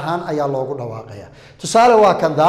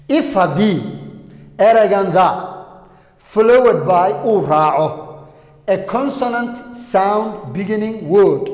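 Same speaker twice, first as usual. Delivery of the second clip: slow, deliberate reading of the rule; slow rule dictation; 3 semitones higher, slower than usual. Shown as a demonstration. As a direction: dictate it word by word. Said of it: "Halkaas u fiirso". (xeerka si tartiib ah u akhri)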